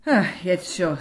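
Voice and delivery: weary sigh into the words. A narrator's reading. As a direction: sigh it out as a concession. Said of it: "seufzte die Mutter".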